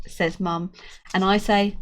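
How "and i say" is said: ordinary delivery, nothing marked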